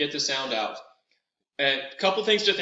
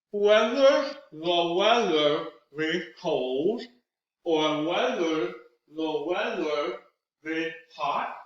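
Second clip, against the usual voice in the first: slow, each syllable drawn out around the fingers; with fingers in mouth, very slow; 2 semitones higher, much slower than usual. Shown as a demonstration. Cork work tongue twister with fingers in the mouth. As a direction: articulate every syllable slowly. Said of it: "two more times with fingers in the mouth".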